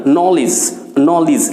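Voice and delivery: নলেজ said nolij, short i in the last syllable; knowledge as nolij. Shown as a demonstration. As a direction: end নলেজ on lij, not lej. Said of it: "লিজ sound হয়".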